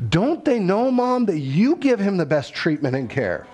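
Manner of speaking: lightly voiced indignant boy; kid voice. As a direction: whine it like a nine-year-old who knows better. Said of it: "He goes".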